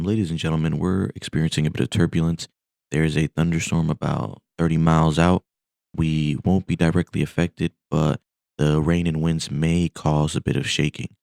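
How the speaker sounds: loud, measured address to the cabin; intercom announcement. Acted. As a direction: announce it to the passengers like a pilot on the intercom. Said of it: "calls back into the passengers on his intercom".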